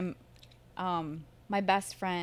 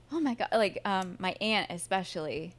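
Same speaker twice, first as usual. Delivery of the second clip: ordinary delivery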